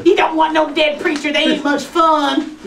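usual speaking voice

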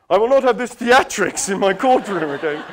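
loud scolding judge; angry judge. Retold or imitated. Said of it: "And the judge said".

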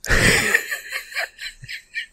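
high breathless laughter at own accent; laughing fit. Real, not acted. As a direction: crack up at a botched line. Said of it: "what was that accent?".

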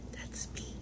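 soft unvoiced aside; hushed aside; whisper. Real, not acted. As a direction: whisper it to yourself. Whispered aside about card.

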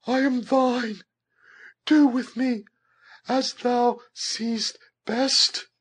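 very high, meek, drawn-out plea; meek yet confiding. A narrator's reading. Captive praying toward heaven, meek and confiding. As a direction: surrender meekly in a high, trusting voice. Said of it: "saying in a meek and yet confiding voice".

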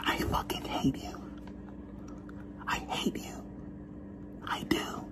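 whispered I fucking hate you, unvoiced. Shown as a demonstration. Speaker whispering the insult to camera, hushed. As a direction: hiss out hate softly in a whisper. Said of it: "whispered then spoken take".

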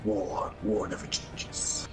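slow solemn game quote; gravely slow quote. Retold or imitated. Friend quotes the Fallout line slowly. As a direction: intone like a narrator. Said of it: "Fallout quote".

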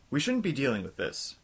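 ordinary talk

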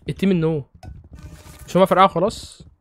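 normal talking voice

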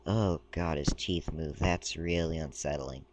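ordinary talk

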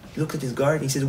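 ordinary delivery

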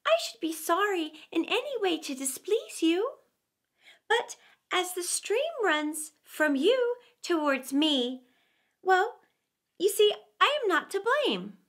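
high, gentle explaining; meek lamb. A narrator's reading. Lamb explaining the stream to the wolf, meek and high. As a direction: timidly reason with the bully. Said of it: "meekly answered the lamb".

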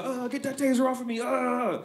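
high strained voice of a guy shaking off a taser; man fighting taser. Retold or imitated. Suspect fighting off the police taser, high and strained. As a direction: squeal like you're shrugging off the shock.

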